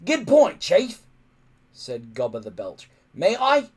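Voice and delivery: loud, high hearty voice; eager to swing the axe; gruff Gobber. Acted. Gobber the Belch eagerly agreeing with the chief, loud.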